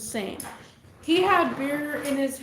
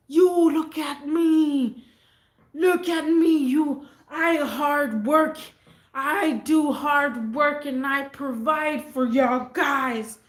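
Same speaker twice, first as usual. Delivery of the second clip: put-on aggressive drunk yell; 3 semitones higher, 4 dB louder, slower than usual. Aggressive drunk dad to kids.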